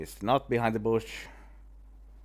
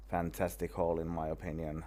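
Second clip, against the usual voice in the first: ordinary delivery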